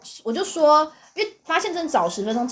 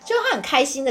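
ordinary talk

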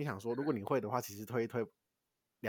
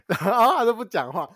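大声边笑边说; 气到笑出来; laughing exasperation; 8 semitones higher, 12 dB louder than usual. Real, not acted. 笑着讲又被无视. (又好气又好笑地说)

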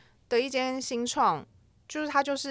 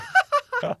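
high laugh; 5 dB louder than usual. Real, not acted. Laughing at slow replies.

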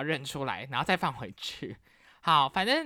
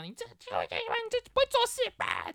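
much higher scolding dad voice; angry dad. Retold or imitated. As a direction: scold like a furious father. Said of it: "气到".

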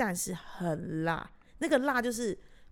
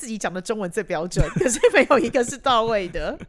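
loud laughing through the words; giggling while teasing; 2 semitones higher, 10 dB louder than usual. Real, not acted. Laughing about everyone's accents.

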